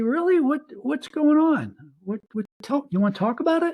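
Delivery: caring wife. Retold or imitated. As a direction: rush in with worried care like a loving wife. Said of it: "if you got a good woman, she's gonna say".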